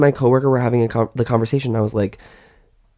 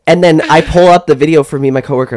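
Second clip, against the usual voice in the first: usual speaking voice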